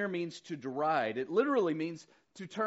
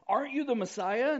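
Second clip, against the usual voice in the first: insulting jeer; 9 semitones higher, 5 dB louder than usual. Retold or imitated. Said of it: "hurled insults at him".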